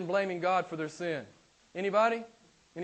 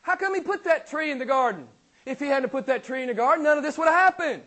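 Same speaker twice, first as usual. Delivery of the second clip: much higher, louder, indignant griping; mock the skeptic blaming God, pitched way up; unbeliever's complaint; 9 semitones higher, 6 dB louder than usual. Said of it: "this is one of the things I always hear".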